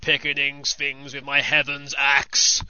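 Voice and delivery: slow gruff rock growl; gravelly metal singer. Shown as a demonstration. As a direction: ham up a heavy metal vocalist. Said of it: "sing in a gravelly voice like this".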